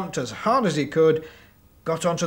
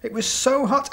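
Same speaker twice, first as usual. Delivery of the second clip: excited, high; 11 semitones higher, 5 dB louder than usual. Acted. Climax of the story.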